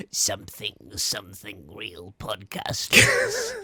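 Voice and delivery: slow, low raspy Sith voice; imitating an evil emperor, slow; Emperor Palpatine. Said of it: "I honestly sound a bit like Emperor Palpatine".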